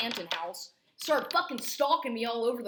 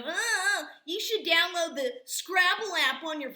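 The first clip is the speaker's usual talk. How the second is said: high, put-on dismissive mother voice; mocking mother; 9 semitones higher, 6 dB louder than usual. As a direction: ape her airy distracted chatter, pitched way up. Imitating mother at hearing.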